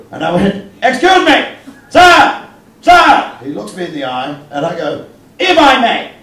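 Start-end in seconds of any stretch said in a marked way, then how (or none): 0.8-3.4 loud, high-pitched heckler's holler; Edwardian heckler. Retold or imitated. Comic heckling the wrestler walking to the ring, loud Edwardian heckler. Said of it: "I did the Edwardian heckler at the wrestling". 5.4-6.1 high, loud, mock-polite interjection; Edwardian heckler. Heckler catches the wrestler's eye, Edwardian heckler. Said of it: "I did the Edwardian heckler at the wrestling".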